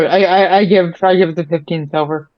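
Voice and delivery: normal talking voice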